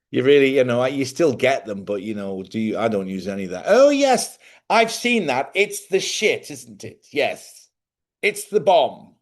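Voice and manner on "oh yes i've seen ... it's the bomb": high-pitched, hammed-up upper-class English accent; prim English accent delivering street slang; mock posh English accent. Mock posh speaker using slang.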